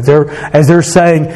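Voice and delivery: normal talking voice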